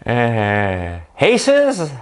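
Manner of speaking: high, tittering acted voice; giggle, then squeak it as a question; big fake smile. Faking tennis talk in the interview, high grinning voice. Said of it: "avec un grand sourire".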